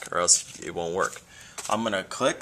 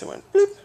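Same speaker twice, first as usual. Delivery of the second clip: high squeaky bloop; bloop sound effect; 6 dB louder than usual. Retold or imitated. Teacher imitating her screen switching, a squeaky bloop. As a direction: chirp the bloop like a computer noise.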